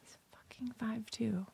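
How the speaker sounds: slow, very soft whisper; whispered aside. Real, not acted. Telling her friend her son's height, whispered in disbelief. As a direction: whisper it as a scandalous confidence.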